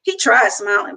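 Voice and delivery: usual speaking voice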